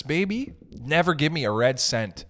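ordinary delivery

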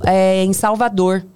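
ordinary talk, nothing marked

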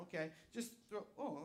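normal talking voice

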